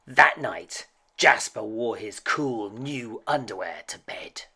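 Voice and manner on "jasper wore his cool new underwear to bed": ordinary delivery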